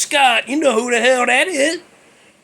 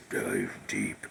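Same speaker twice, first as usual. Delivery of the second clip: much lower and softer, a breathy demon rumble; devil voice; 14 dB quieter than usual. Retold or imitated. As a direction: sink the voice down like a devil. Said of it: "he talks like this".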